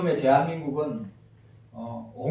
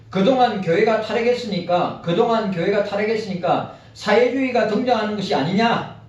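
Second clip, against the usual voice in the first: reading aloud; 3 semitones higher, 4 dB louder than usual. Acted. Continues reading the article.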